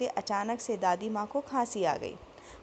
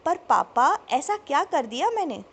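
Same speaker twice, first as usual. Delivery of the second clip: put-on higher, louder startled teen; a hurt, puzzled objection; surprised protest; 4 semitones higher, 6 dB louder than usual. A narrator's reading. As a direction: son baffled by his father's anger, raised voice. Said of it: "हर्ष ने हैरानी से जब पूछना चाहा".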